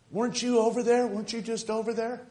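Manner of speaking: high-pitched, teasing challenge; retold pointed question. Preacher challenging a man seen at the ball game, high pointed question. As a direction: imitate someone catching him out, voice lifted high.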